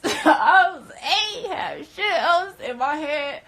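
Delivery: high, loud, slow through laughter; cracking up mid-sentence; laughing hard. Real, not acted. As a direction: push the words out through a laugh. Cracks up at own story.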